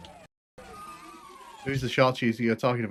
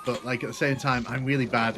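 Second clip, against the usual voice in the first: ordinary talk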